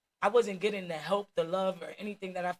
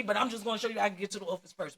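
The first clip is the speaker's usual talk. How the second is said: ordinary talk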